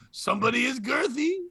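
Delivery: high, sly mock-French tease; French accent. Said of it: "in French, you could just do that".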